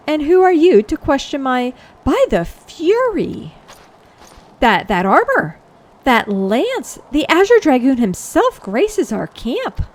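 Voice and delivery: theatrical knight, affronted then astonished; haughty challenge breaking into awe; indignant knight turning awed. Acted. Voices knight recognising the hero.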